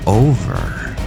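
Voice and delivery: usual speaking voice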